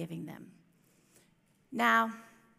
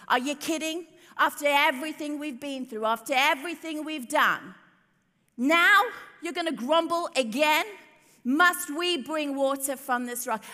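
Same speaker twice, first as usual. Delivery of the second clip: louder, higher exasperated rant; annoyed Moses; 4 semitones higher, 7 dB louder than usual. Acted. Moses scolding the grumbling Israelites, loud and exasperated. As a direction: let the fed-up leader boil over. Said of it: "He's annoyed with these people".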